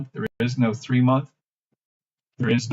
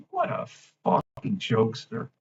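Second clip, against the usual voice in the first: usual speaking voice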